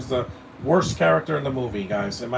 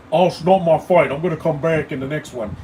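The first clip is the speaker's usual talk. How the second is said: very loud, higher-pitched British punk caricature; hammed-up British accent, shouted mockingly; mock British accent; 3 semitones higher, 7 dB louder than usual. Reviewer mocking the punk who disappears in the third act, loud British accent. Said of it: "I don't like his accent".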